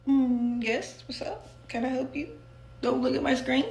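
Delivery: mock a cagey guy shooing you away from his screen; defensive vibe. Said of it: "type of vibe".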